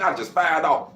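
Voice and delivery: ordinary talk, nothing marked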